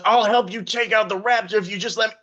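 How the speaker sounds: high, desperate wheedling; pleading CEO. Acted. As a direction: beg like a cornered exec. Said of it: "He goes".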